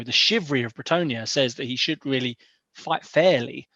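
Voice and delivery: ordinary delivery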